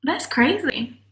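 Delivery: usual speaking voice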